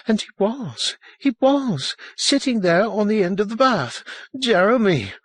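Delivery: very high, frantic insistence; high-pitched panicked revelation; mounting hysteria. A narrator's reading. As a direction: build to a horrified cry on the name. Her account climbs to hysteria.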